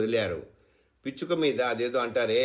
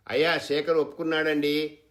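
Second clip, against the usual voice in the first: raised, higher call to the landlord; loud eager announcement; loudly; 6 semitones higher, 5 dB louder than usual. A narrator's reading. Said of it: "గట్టిగా అని".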